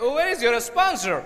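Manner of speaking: imitated American accent, slow. American managing director asks for the sponsor, accented. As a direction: mimic the American boss, drawn out. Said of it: "American accent था".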